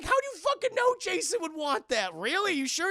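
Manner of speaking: high, loud incredulous shout; angry challenge. Retold or imitated. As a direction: throw it back at him, furious. Friend challenging his plan.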